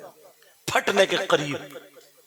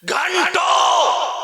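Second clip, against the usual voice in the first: drawn-out shout; 8 dB louder, much slower than usual. Acted. Sermon climax, drawn-out shout.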